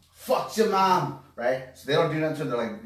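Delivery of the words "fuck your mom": put-on louder, higher, drawn-out drunken taunt; drunk heckler. Older drunk to a group of guys, drunken taunt. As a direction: holler it slowly like a barfly. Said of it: "there's an older drunk gentleman".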